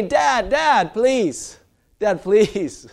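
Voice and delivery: put-on high, loud pleading kid voice; kids begging. Scared kids begging their dad to fetch it, high pleading. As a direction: beg Dad like a scared child.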